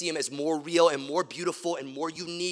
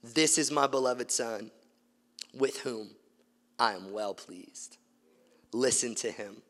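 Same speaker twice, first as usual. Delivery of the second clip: low, softer, measured divine voice; voice of God; 6 semitones lower, 5 dB quieter than usual. Acted. God's voice from the cloud names his Son, low and measured. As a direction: lower and quieter, with solemn pauses. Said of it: "And this voice says".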